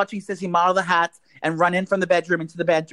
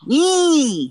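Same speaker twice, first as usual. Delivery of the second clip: excited squeal; 10 semitones higher, 4 dB louder than usual. Real, not acted. Excited about placenta pill.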